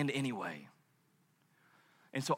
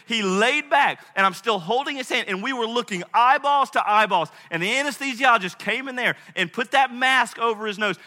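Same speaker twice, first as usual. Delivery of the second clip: loud, raised, emphatic sermon voice; impassioned preaching; 5 semitones higher, 7 dB louder than usual. Acted. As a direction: drive each point home to the congregation. Preaches at full force.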